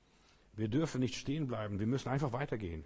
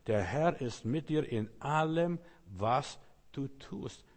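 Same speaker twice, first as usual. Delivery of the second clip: slow emphatic repeat; slower than usual. Shown as a demonstration. Preacher repeats phrase slowly.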